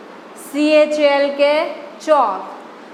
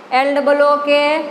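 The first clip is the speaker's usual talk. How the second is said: usual speaking voice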